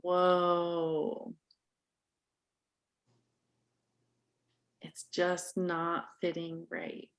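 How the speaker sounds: slow, soft, settled voice; slow and low. Shown as a demonstration. Coach showing the calm version to the parent, slow and soft. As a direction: take it down low and slow, calming.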